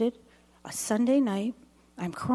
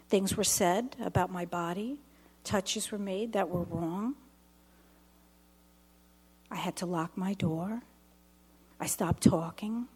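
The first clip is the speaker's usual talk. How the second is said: ordinary talk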